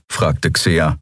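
ordinary delivery

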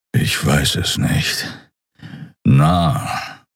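slow, low half-whisper; low uncertain Kero. A narrator's reading. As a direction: trail off low. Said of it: "Kero unsure".

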